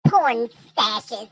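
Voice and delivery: ordinary talk, nothing marked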